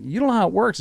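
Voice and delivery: high, rising objection; protesting retort. Retold or imitated. Husband arguing with his wife, high protesting retort.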